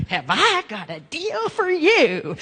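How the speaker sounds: slow, oily huckster pitch, stretching each word; slick salesman. Retold or imitated. Seminar host mocking the classic slick salesman, slow and oily. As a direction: lay it on thick like a cheesy car salesman. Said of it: "How many of you know this guy right here?".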